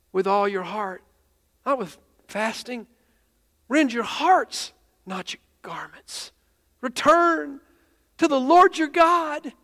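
halting, fervent scripture reading with rising pitch; emotional young preacher, voice climbing; imitating tearful reading. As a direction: plead it out in broken, slow phrases. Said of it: "And he stood there crying".

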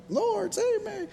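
mock-panicked falsetto plea, extremely high; falsetto cry. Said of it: "Falsetto, I'm not doing it this morning, but".

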